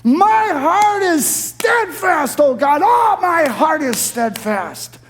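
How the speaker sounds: very high, slow, exultant shout; excited, emphatic proclamation. Acted. Preacher voices David's twice-said resolve, excited emphatic proclamation. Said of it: "Oh, I get so excited".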